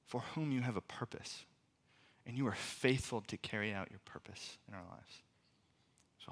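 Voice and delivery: hushed, unhurried pastoral prayer. Real, not acted. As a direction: lead the room quietly in prayer. Leads the congregation in prayer.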